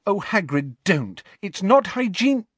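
Hermione protesting. A narrator's reading. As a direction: stop him, half horrified. Hermione protests Hagrid's steak.

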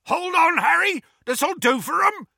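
high, loud roar; roaring Hagrid. Acted. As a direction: roar it with glee. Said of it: "roared Hagrid".

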